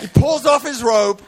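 high-pitched dramatic sermon telling; impassioned preaching. Acted. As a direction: preach the story at full pitch. Preaches at full force.

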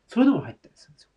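そういうのも入ったりするんですよ rushed out in a fast breathy whisper; quick whisper. Real, not acted. To viewers, an aside about fillings, fast whisper.

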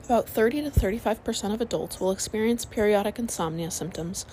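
usual speaking voice

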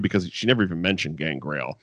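ordinary talk

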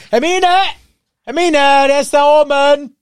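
high, loud shout across the house; calling out. Retold or imitated. Ron shouting to Hermine that Harry is upstairs, loud high call. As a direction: holler to a friend that he's upstairs.

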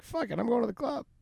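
high, bright party-boy bravado, reenacted; high-pitched imitation of his drunk self; mock reckless cheer. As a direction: toss it off like a cocky drunk deciding to party. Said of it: "And I'm like".

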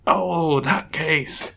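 put-on slow, high, drawn-out elderly voice; old man. Old judge recalls a long-ago case, old man voice. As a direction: draw it out like a frail old judge. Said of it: "So he's old".

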